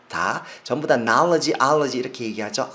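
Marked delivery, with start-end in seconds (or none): 1.0-2.0 "knowledge, allergy" said as "NAH-luh-jee, AH-luh-jee", loud; "ah" pronunciation. Shown as a demonstration. Teacher summing up both suffixes, open "ah". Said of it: "아로 발음하시면 됩니다".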